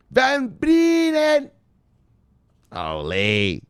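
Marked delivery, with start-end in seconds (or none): 0.1-1.5 slow drawn-out call. Acted. Name called slowly again.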